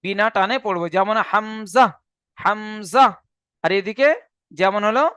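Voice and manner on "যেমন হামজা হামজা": "হামজা" said short and crisp, no lengthening; unstretched hamza. Shown as a demonstration. Teacher showing a letter read without stretch. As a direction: clip "হামজা" with no drawn vowel. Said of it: "সেখানে আমরা মোটেও টানবো না".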